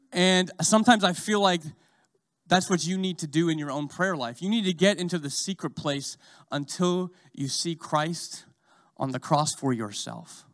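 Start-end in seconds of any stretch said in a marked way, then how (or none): none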